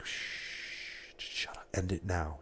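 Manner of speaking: hushed, breathy mock whisper; a viewer's private hush, whispered; imitated whisper. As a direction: whisper it like a secret plea to stop. Viewer's imagined private message to him.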